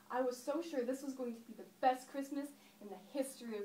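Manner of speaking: loud, emphatic stage enthusiasm; acted joy, pushed out loudly; excited recollection. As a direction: gush with a child's remembered certainty. [name] relives childhood Christmas joy.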